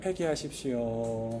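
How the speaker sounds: slow, soft, tender call; loving exhortation. Shown as a demonstration. 옆 사람에게 사랑을 담아 부드럽게 천천히. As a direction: say it gently with love. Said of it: "옆에 분에게 사랑의 말씀을 담아서".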